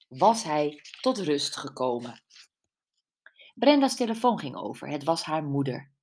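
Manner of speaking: usual speaking voice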